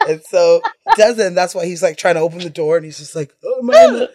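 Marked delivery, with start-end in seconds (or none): 3.4-4.1 frustrated groan. Retold or imitated. Struggling with door.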